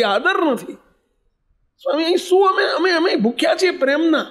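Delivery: breaking, pleading voice; anguished lament. Retold or imitated. Old father's emotional lament. (પ્રેમ માટે તરસતા પિતાનો ઊંચો, રડમસ વિલાપ)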